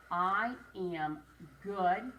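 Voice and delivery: I am good stretched very slowly; slow dictation. Shown as a demonstration. Teacher slowly dictating a sentence to children. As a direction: spell it out slowly for the class. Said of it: "What's the first sound you hear in good".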